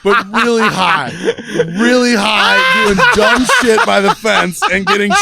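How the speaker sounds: words breaking up in real laughter; laughing. Real, not acted. Cracks up correcting himself.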